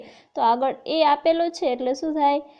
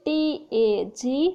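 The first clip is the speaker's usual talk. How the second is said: slow spelling out; slower than usual. Shown as a demonstration. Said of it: "એનું ઉચ્ચારણ થાય".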